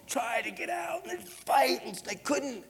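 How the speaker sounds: high, effortful strain; mock struggle; straining to break free. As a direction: act out the struggle against the rope. Acting kids straining against twine.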